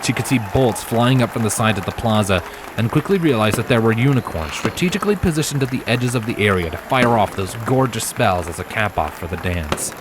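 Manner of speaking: plain reading voice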